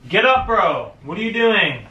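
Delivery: ordinary delivery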